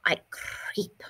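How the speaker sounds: whispered, sly and slow; creepy whisper. Acted. Lizard circling Martina, whispering menacingly. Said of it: "he said, circling Martina".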